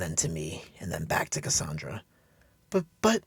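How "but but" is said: high, frightened stammer; high, stammered but, but. Acted. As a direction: stumble over the words in fright. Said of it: "she stammered".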